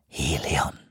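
low, soft hiss. A narrator's reading. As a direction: lean in and hiss it. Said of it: "zischte er".

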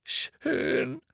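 very high, drawn-out tearful assent; sobbing. A narrator's reading. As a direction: whimper a sulky agreement. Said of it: "sagte Hermine und schluchzte".

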